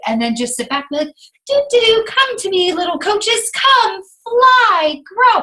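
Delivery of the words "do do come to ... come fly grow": high, loud sing-song summons; high, loud whimsical spell; mock fairy. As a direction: conjure coaches like a fairy godmother. Mocking magical wishful thinking.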